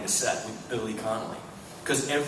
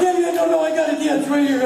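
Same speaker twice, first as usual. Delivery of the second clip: high, loud panicky imitation; mock fluster; 10 dB louder, faster than usual. Panicked friend realising he holds his phone, imitated fluster. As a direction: babble it frantically.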